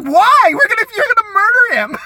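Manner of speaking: high, loud disbelieving cry; alarmed protest. Retold or imitated. Telling the girl not to hit the boy, shocked high outcry. Alarmed reply to violent plan.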